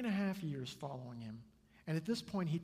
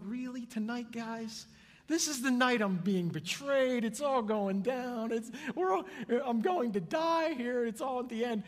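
raised, exasperated outburst easing off at the end; frustrated Jesus; 8 semitones higher, 7 dB louder than usual. Acted. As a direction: scold them in loud, high-pitched disbelief. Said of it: "he had to be so frustrated".

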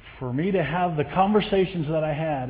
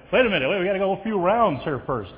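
playful mock stalling; 5 semitones higher, 4 dB louder than usual. Jokingly retelling his own stalling.

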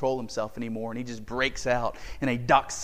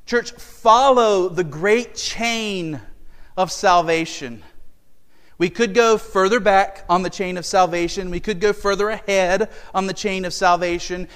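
loud, raised preaching call; rally the church, louder; preaching at full force; 5 semitones higher, 7 dB louder than usual. Acted.

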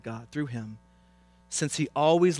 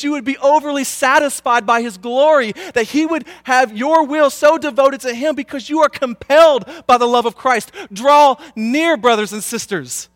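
high, loud, impassioned exhortation; full-force preaching; 12 semitones higher, 13 dB louder than usual. Real, not acted.

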